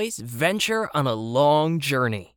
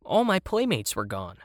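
ordinary delivery, nothing marked

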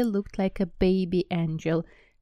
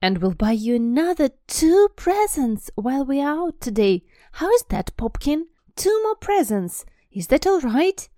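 much higher, louder, hurried pleading; mother hastily offering more presents; anxious doting mother; 8 semitones higher, 7 dB louder than usual. Acted. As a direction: rush to bribe the child before a tantrum. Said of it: "she said quickly".

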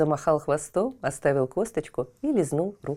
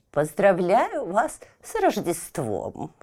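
put-on slow creaky old woman; 3 semitones higher, slower than usual. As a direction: crone wishing a merry Christmas. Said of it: "скрипучим голосом".

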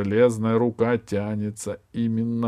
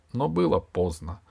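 usual speaking voice

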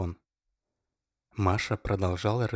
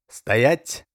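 loud, ringing, falsely cheerful shout; 5 semitones higher, 8 dB louder than usual. A narrator's reading. Oleg stops Masha's move with a loud fake-cheerful shout. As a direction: bark it out brightly but fake. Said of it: "звонко и как будто весело крикнул Олег".

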